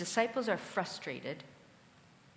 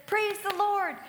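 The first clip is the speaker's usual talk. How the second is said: much higher and louder, bursting with glee; triumphant and laughing at the end; clapping and cheering victory; jubilant shout; 10 semitones higher, 7 dB louder than usual. Retold or imitated. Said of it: "jumping up and down and clapping her hands".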